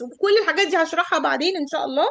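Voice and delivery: usual speaking voice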